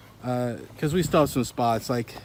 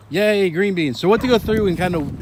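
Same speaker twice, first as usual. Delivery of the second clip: excited; 5 semitones higher, 5 dB louder than usual. Real, not acted. Cheering sprouted green beans.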